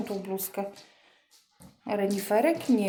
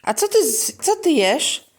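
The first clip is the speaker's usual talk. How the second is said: playful surprise; 5 semitones higher, 6 dB louder than usual. Real, not acted. Mock surprise to toddler.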